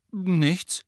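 high, hasty answer; quick denial. A narrator's reading. Ron dodges Hermine's question, hasty. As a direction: blurt it out fast. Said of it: "sagte Ron schnell".

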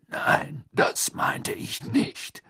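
rough, slow, voiceless growl; rasping, whispery gruff man; gruff growler. A narrator's reading. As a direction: growl a correction, drawn out.

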